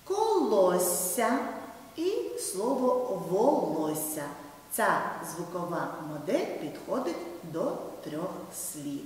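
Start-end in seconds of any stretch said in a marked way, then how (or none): 0.0-4.2 читання, колосся, волосся read slowly, the doubled consonants drawn out; slow, clear reading. Shown as a demonstration. Teacher reads the matching words slowly to pupils. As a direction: spell it out slowly for the children. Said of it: "teacher shows the words slowly".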